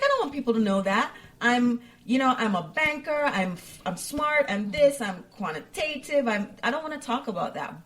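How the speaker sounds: high, quick, insistent protest; exasperated professional woman. Retold or imitated. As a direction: huff it out like you're fed up with the label. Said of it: "She's like".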